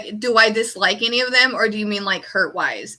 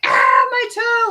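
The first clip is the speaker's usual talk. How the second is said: high, slow, hammed-up cry of pain; mock dramatic wail; 12 semitones higher, 4 dB louder, slower than usual. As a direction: overact a stubbed toe. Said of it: "fall on the floor and be like".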